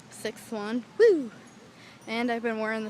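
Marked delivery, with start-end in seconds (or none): 1.0-1.3 a high, loud little woo; quick happy whoop; excited cheer. Real, not acted. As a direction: whoop with delight. Cheering about sixth Warped Tour.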